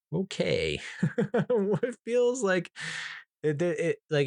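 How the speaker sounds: laughing through the words, slow and high; giggling as he starts the thought. Real, not acted. Reviewing a grim movie, laughing at its bleakness.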